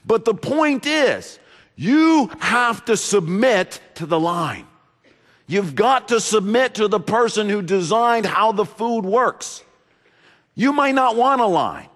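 high, loud, driving sermon delivery; preaching at full force. Acted. Pastor urging the congregation, at full force. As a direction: preach it out to the whole church.

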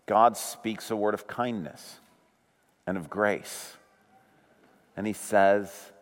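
low, soft, measured delivery with pauses; hushed solemn preaching. Acted. Preacher telling what God says into the chaos, hushed and solemn. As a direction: let it drop into a reverent hush. Preacher lowers voice for God's word.

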